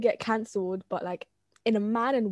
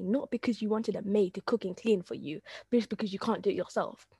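usual speaking voice

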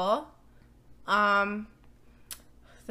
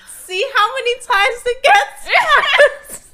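laughing; 13 semitones higher, 8 dB louder, much slower than usual. Real, not acted. Joking about the collection basket.

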